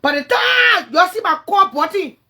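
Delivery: slow, high, loud boast; shout. Real, not acted. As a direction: bellow it slowly at her. Boasting at rival in rant.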